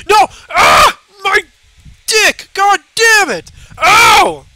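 high, loud angry yelling; screaming while falling. Acted. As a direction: scream and curse at the fall. Another painful fall in game.

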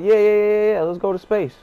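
rapid excited yeses; hyped agreement. Retold or imitated. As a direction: pile on the yeahs like you can't wait. Friends hyped to go.

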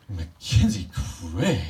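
slow, loud, drawn-out mock-shocked name; mock surprise. Imitating Natasha's fake shock at her brother, loud and slow. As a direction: act all surprised at his name. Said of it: "she would act all surprised".

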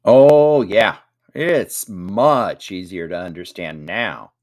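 loud, drawn-out mock agreement; big loud "Oh", then slow teasing; joking. A narrator's reading. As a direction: play it up as a slow, wry joke. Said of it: "joked Jack".

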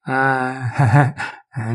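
short amused laugh before the reply. A narrator's reading. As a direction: chuckle it off, pleased with himself. Kuzma laughs, twirling his moustache. (risata compiaciuta e breve)

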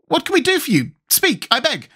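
very high, tearful-sympathy pleading; entreating. A narrator's reading. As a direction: plead with him in a high, anxious voice. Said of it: "entreated the Tin Woodman".

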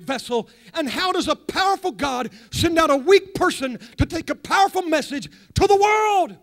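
a sing-song holiness preacher winding up to the climax; preaching at full force. Acted. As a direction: whoop it up like a revival preacher. Preaching builds in intensity.

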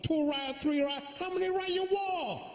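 very high Chinese waiter caricature; squeaky cartoon Chinese accent; mock Chinese accent. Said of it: "same accent as the waiter".

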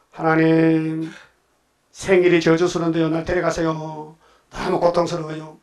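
slow, drawn-out plea in pain; acted weary wail, stretching the words; suffering lament. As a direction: plead to God slowly, dragging each ending. Voices sick Job pleading with God.

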